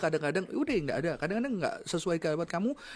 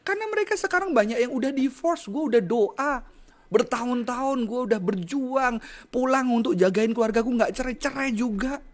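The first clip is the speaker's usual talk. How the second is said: emotional venting; 8 semitones higher, 3 dB louder than usual. Retold or imitated. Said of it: "Kok gue jadi emosi ya?".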